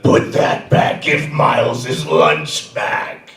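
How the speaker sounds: menacing whisper, slow; teacher's scary whisper. Shown as a demonstration. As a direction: hiss it low to scare him. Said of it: "in my scary voice".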